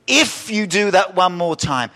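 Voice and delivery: loud, high angry parent warning; mock parent threat. Preacher mocking a parent's threat, loud and high. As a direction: bark it like a fed-up parent.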